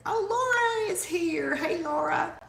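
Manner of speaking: delighted to see a friend arrive; excited greeting. Real, not acted. Host spots Laura joining the livestream, delighted and high.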